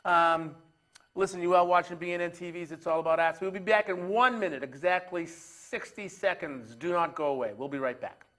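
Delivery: presenter's sign-off before a commercial; TV host to camera. Real, not acted. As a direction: throw to the break, strong and punchy. Host announces break to camera.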